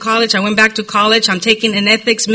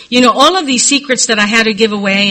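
ordinary talk, nothing marked

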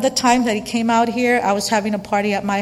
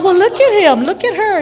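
high, loud retold gossip; mock gossiping; 10 semitones higher, 8 dB louder than usual. Speaker gossiping about AA members, high loud mock commentary. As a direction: point people out, imitating a loud gossip.

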